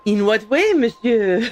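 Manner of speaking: questioning in a mock-French accent; hammed-up French accent; fake French accent. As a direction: ask it with a put-on French accent. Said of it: "the fake French".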